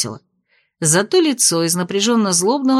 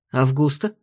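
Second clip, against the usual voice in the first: low stern director voice; 7 semitones lower than usual. Acted. Director suspicious.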